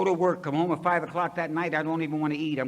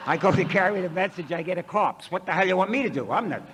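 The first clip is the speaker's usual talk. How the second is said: loud, higher, laughing outburst at the corpse; exasperated shout; 5 semitones higher, 8 dB louder than usual. Retold or imitated. Yelling at the dead man.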